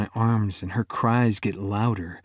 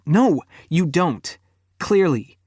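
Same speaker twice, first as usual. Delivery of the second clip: angry retort; 7 semitones higher, 4 dB louder than usual. A narrator's reading. Girlfriend cutting him off angrily, higher and louder. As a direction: snap it back coldly.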